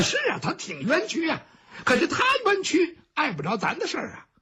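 声调抬高，边笑边说; 带着笑腔急着辩解; 笑着说. Acted. 包兴边笑边辩. (包兴对三公子笑着抢白)

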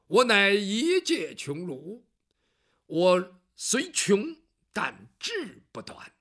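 very slow, dignified scholar; a poor scholar declaring his dignity slowly; proud declaration. Acted. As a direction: speak each word with pride.

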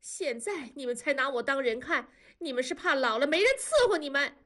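high-pitched tearful outburst, rushed in bursts; wailing. A narrator's reading. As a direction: hurl accusations through acted sobs. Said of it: "多多嚎哭地说". (哭嚎着控诉)

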